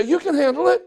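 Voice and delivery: wife rallying her husband, lightly played cheerleader. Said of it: "be their cheerleader and say".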